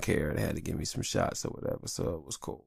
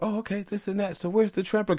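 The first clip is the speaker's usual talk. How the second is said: high, mocking kid voice; lightly imitating a kid asking for gifts; mock eager kid; 13 semitones higher than usual. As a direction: play the kid fishing for the present. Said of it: "'cause I'm like".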